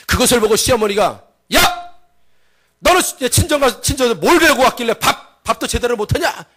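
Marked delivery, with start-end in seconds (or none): 1.5-6.4 high-pitched angry telling-off; acted scolding from a mother-in-law. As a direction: open with a sharp yell, then scold. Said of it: "시어머니가 야단친 거니까".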